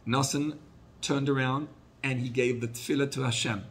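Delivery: normal talking voice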